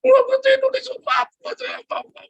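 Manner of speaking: very high whiny mock outburst; imitated tantrum. Preacher imitating his child woken from sleep, mock tantrum. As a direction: squeal it like a child throwing a fit. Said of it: "Ngamuk ini, Saudara, si Miracle".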